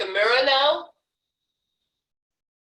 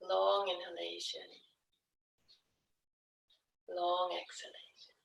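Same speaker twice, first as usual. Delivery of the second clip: soft, hushed yoga-teacher voice; guided breathing cue; 8 dB quieter than usual. Real, not acted. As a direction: ease them gently into long breaths. Guides a slow breathing exercise.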